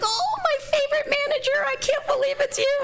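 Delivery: very high, squealing gush of delight; gushing former employee. Retold or imitated. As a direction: squeal it like an old coworker thrilled to see you. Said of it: "She's like".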